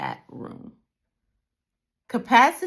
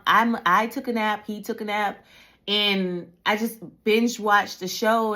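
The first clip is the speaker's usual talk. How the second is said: usual speaking voice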